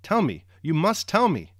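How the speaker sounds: urgent, mounting plea, high and loud; almost crying out. A narrator's reading. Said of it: "she almost cried".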